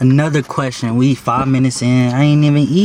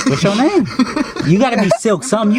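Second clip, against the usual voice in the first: put-on high girly voice; 9 semitones higher than usual. Acting out a girl asking.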